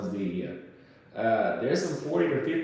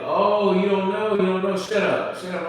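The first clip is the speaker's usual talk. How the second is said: high, loud taunting retort; aggressive mocking shout; 8 semitones higher, 5 dB louder than usual. Cop silencing a passenger at gunpoint, aggressive. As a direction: cut him off, loud and high. Acted cop berating him.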